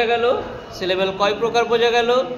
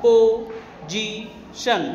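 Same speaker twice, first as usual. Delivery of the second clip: very slow, drawn out in three beats; syllable by syllable; much slower than usual. Shown as a demonstration. Teacher showing a word made in three tries, syllable by syllable. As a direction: break "position" into প, জি, শন with gaps. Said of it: "তিনবারের চেষ্টায় করেছে".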